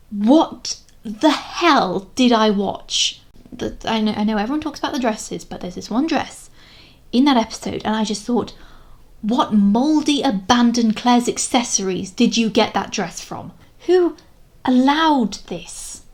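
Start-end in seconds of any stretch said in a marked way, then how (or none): 0.1-3.2 slow, loud, drawn-out bafflement; exasperated disbelief. Real, not acted. Baffled reaction to a show. 9.2-16.0 higher, slow, mock-outraged demand; hammed-up horror, stretching the key words; appalled outrage. Acting out appalled thought at dress.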